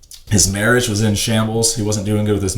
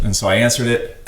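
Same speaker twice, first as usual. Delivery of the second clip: ordinary talk, nothing marked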